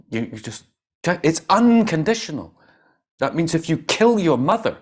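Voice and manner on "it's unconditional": "unconditional" high, loud and leaned on; emphatic insistence. Real, not acted. Teacher driving the point home, emphatic "unconditional".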